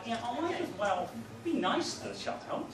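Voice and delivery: normal talking voice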